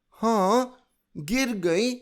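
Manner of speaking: put-on high little girl guessing; a knowing six-year-old, high; eager child. A narrator's reading. Chilki guesses granny fell, high child voice.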